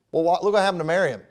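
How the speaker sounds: usual speaking voice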